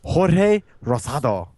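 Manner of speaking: slow careful try at "Jorge Rosado" with a rolled R; host gamely trying a Spanish name; attempted Spanish name. Real, not acted. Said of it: "I'm definitely saying that wrong".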